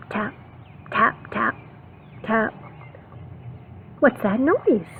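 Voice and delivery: ordinary delivery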